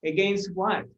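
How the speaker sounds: normal talking voice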